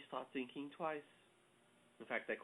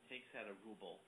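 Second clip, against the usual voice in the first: normal talking voice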